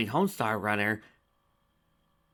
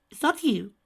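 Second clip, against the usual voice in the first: high, faint-sounding question; lightly voiced weak mare; 8 dB louder than usual. A narrator's reading. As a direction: call out weakly from inside. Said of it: "groaned Rarity".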